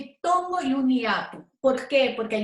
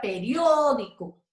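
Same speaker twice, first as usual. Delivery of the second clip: periódico slowed, long punched Ó; stress on the Ó; slower than usual. Shown as a demonstration. Teacher giving a proparoxytone example, Ó drawn out. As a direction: stretch the Ó in periódico, then drop away. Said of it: "acá tenemos el caso de una esdrújula".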